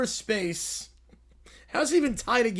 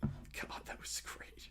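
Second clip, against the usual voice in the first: whisper; 24 dB quieter, slower than usual. Real, not acted. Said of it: "whispered aside".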